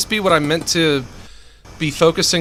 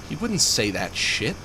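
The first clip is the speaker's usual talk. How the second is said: usual speaking voice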